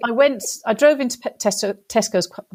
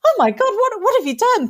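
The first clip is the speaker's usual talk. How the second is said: husband's alarmed cry, higher; mock alarm; 12 semitones higher than usual. Husband hearing his wife crying, alarmed. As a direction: gasp it out like a startled husband. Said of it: "he was like".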